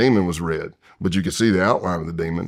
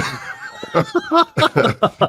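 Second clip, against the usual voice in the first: high burst of laughter. Real, not acted. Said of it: "I don't imagine you driving a Volkswagen Bug".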